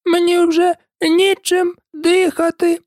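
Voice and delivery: high, slow squeaky complaint; a tiny creature squeezed and grumbling; high and slow, crushed and grumbling; Dormouse voice. A narrator's reading.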